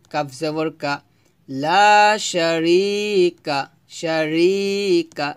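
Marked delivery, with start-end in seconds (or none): 1.5-5.3 "শারিকা" slow, stretching the "ri"; measured recitation with a long vowel in the "ri" of "শারিকা"; lengthened "ri". Shown as a demonstration. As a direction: say "লা শারিকা" as "laa sha-reee-ka". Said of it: "রি এক লিপ টান হবে".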